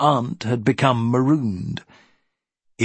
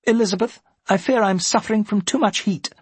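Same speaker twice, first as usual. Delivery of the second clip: put-on much higher-pitched older lady's voice; interrupting aunt; 10 semitones higher, faster than usual. Aunt making an excuse to her niece, high-voiced complaint.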